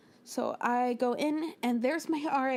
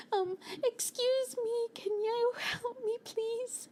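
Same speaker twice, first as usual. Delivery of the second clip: much higher, slow, shy asking voice; timid high plea; 9 semitones higher, 3 dB quieter, slower than usual. Retold or imitated. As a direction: ask politely like a lost newcomer. Polite plea to students.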